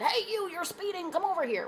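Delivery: mock police officer hailing a driver; imitating an officer. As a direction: play the officer waving her down. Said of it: "and said".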